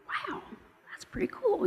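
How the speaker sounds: high whispered amazement; soft, high, whispered wonder; hushed wow. Retold or imitated. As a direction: breathe it in quiet awe. Retold reaction to interview.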